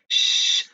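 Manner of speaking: long hushing "shh"; shushing. Shown as a demonstration. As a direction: shush someone in a library. Acts out shushing a student.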